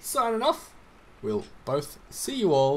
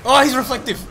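alarmed shout; 9 semitones higher, 12 dB louder than usual. Real, not acted. Spots a dangerous mob.

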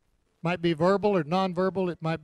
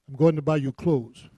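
louder, insistent imitation of a Ghanaian accent; Texas-tinged Ghanaian accent; 5 dB louder than usual. Ugandan partner insists on buying the preacher clothes, in rough Ghanaian accent. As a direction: put on an imperfect Ghanaian accent. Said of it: "Sorry about my Texas, uh, Ghanaian accent".

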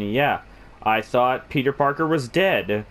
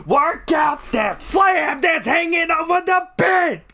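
yelling a wake-up call, louder; shouting; 4 dB louder than usual. Pretend. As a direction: bellow at a sleeping kid to get up. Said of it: "Sorry, I couldn't help it".

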